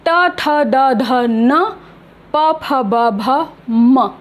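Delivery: teacher modelling त to न and प to म; pronunciation demo. Shown as a demonstration. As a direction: recite त, थ, द, ध, न, then प, फ, ब, भ, म as separate syllables. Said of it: "एक सा ही उच्चारण स्थान".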